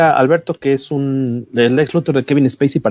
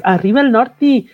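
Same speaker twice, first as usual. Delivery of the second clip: put-on northern Mexican accent; 9 semitones higher, slower than usual. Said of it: "me da permiso de hablar como norteño".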